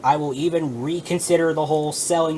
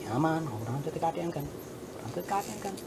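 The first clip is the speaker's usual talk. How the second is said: strained mutter; 10 dB quieter, faster than usual. Real, not acted. Coaxing figurine, strained whisper-mutter.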